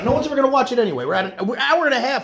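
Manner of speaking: high, loud outburst; loud, racing exclamation at a shock; startled disbelief. Real, not acted. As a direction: blurt it out fast and high. Seeing how long they have recorded.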